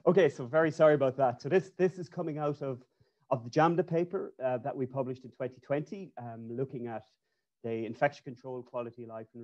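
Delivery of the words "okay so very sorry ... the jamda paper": louder, slightly raised voice on request; speaking up. Real, not acted. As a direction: speak up for a poor connection. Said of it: "speak a bit loud".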